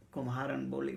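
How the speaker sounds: ordinary delivery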